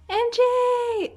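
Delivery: high gasping squeal of delight; excited shriek. Real, not acted. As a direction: squeal the name, thrilled. Gasps opening a photo card.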